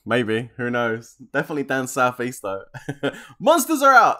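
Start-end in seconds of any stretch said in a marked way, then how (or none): 3.4-4.2 high, loud gamer shout; real excited yell; excited call-out. Real, not acted. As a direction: burst out as the monsters appear. Calling out monsters' return in game.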